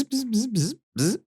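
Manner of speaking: high buzzing zipper imitation; zipper noise. Telling a friend about the bathroom, mimicking a zipper's buzz. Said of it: "That's my zipper".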